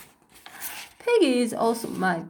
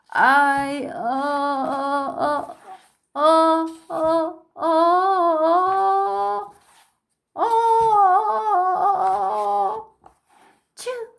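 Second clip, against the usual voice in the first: building sneeze; 6 semitones higher, much slower than usual. Acted. Gerald building up to a sneeze in front of Piggie, climbing then bursting.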